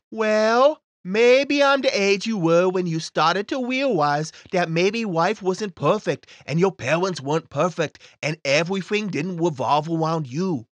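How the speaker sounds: put-on a smug kid explaining; little boy voice. Timmy voice answers.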